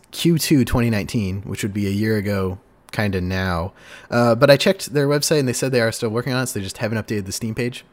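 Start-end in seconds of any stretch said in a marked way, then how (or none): none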